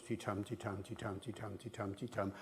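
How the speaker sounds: soft "ti-tum" chant, weak ti, strong tum; iambic beat. Shown as a demonstration. Lecturer showing traditional British rhythm, soft iambic beat. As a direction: tap out "ti-tum" quietly and evenly. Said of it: "That's traditional British English".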